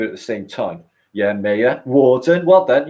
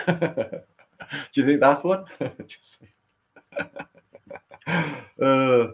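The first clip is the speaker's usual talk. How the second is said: laughing; 5 semitones higher, 3 dB quieter, much slower than usual. Real, not acted. Laughing at own Hooker joke.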